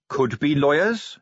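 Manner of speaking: troll grudgingly allowing it; conceding troll. A narrator's reading. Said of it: "he conceded".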